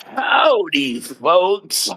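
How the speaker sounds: imitating a friend's signature greeting; Biggie. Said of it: "as Biggie would say".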